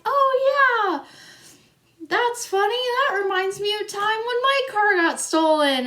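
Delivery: high, airy, carefree retelling; high chirpy imitation of Hannah; Hannah's breezy voice. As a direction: mimic a friend who finds it all amusing. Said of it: "Hannah's like".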